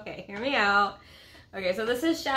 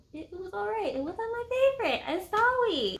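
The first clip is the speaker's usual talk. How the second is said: high, fast, sheepish apology; high-pitched apologetic verdict; 12 semitones higher than usual. Real, not acted. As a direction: squeak out the apology.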